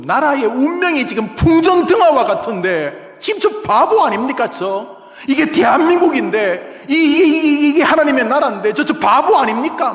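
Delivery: loud, high, exasperated shouting; desperate outcry. Acted. Habakkuk protesting over his doomed nation, desperate outcry. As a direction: rail at God about the foolish king. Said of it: "막 하면서 지금 하박국이 절규하고 있는 것이죠".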